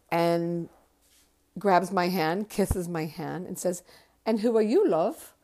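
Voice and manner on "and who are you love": louder, half-hearted Cockney; attempted Cockney accent. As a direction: try a Cockney lilt, not quite managing it. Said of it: "the Cockney accent".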